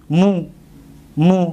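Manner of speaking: مو with a short, firm u; mu sound demo. Shown as a demonstration. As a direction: clip out مو to show the damma.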